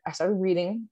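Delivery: ordinary talk, nothing marked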